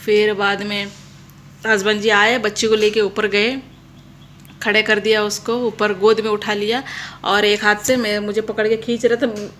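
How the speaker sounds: usual speaking voice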